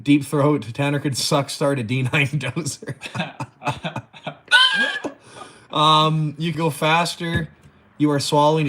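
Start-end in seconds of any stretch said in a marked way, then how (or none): 3.0-5.1 very high shrieking burst of laughter. Real, not acted. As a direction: let a squealing laugh break out. Laughing at a comment.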